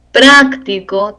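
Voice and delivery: "práctico" slowly with a strong first syllable; stress on A. Shown as a demonstration. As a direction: hit PRÁC in "práctico". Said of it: "tilde en la A".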